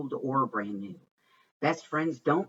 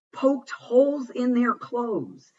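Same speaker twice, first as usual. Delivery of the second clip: excited; 10 semitones higher, 6 dB louder, slower than usual. A narrator's reading. Climax of the poem.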